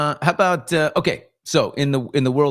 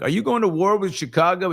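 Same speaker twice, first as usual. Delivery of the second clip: higher, mock-earnest reporter's question; mimicking the reporter; 8 semitones higher than usual. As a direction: parody a pushy reporter asking "going". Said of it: "He's like".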